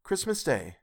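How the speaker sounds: husband calming his wife; mild reminder. A narrator's reading. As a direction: remind her softly. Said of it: "was Bob's mild answer".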